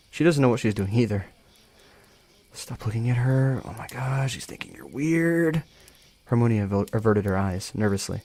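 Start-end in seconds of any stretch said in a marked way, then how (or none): 2.5-5.6 high, drawn-out self-scolding at the end; mock panic climbing higher and slower; panicked inner voice. Character's panicked inner thought.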